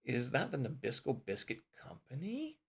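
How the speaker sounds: COMPANY swooping up high at the end; big lengthened rise on COMPANY; rising question. Shown as a demonstration. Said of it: "goes up at the end".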